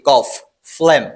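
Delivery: ordinary talk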